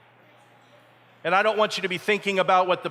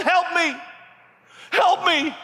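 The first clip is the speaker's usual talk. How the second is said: high, loud cry for help; desperate yell; 6 dB louder, slower than usual. Acted. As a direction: yell out as if sinking. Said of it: "he looked up at him and he yelled".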